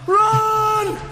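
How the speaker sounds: loud high cry to flee; panicked shout. Acted. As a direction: yell run in terror. Servant panics at rising giant.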